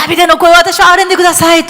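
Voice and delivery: high, loud desperate shout. Retold or imitated. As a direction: yell the plea at full voice. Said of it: "と大声で叫んだんです".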